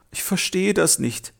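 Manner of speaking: high, plaintive lament of a sad tree; wailing. Acted. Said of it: "jammerte der Baum".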